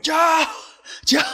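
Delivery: high, loud shout across water; reenacted urgent calling. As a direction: holler to warn a distant friend.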